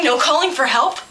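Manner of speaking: ordinary delivery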